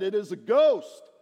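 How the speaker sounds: loud, alarmed cry within a scripture reading; terrified cry. A narrator's reading. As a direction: read it as a startled outcry. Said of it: "they were terrified and said".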